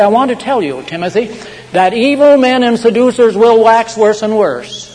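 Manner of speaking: high, loud scriptural warning; forceful preaching. Acted. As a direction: proclaim the warning like a fiery preacher. Preaches Paul's warning at full force.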